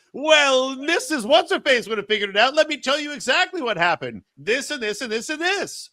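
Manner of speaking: high-pitched, scornful lecture; mock berating. Host imitating the suspect berating the detective, high and scornful. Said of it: "Ken's just berating Columbo like".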